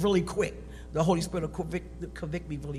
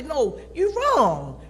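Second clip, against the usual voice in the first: slow, higher and firm; slow insistent correction; 8 semitones higher, 5 dB louder, much slower than usual. Retold or imitated. What she wants her husband to tell her, slow and firm. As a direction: spell it out plainly and slowly.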